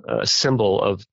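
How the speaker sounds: ordinary talk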